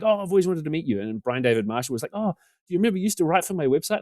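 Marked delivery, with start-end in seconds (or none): 0.0-1.0 high, gushing mock greeting; acted-out excited welcome, pitched up; delighted greeting. Retold backstage greetings from fans. 2.1-4.0 eager reminder. Retold or imitated. Website owner's question, acted out.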